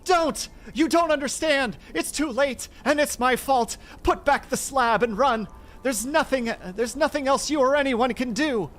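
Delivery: very high, loud, frantic pleading; despairing scream. Acted. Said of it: "changed to a scream of utter despair".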